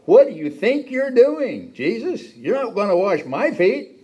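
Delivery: high, loud, slow indignant protest; outraged disbelief. Acted. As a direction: balk at the master stooping. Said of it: "he probably said".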